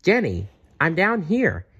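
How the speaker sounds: a hidden bear calling up cheerfully; merry voice. Acted. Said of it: "said a merry voice".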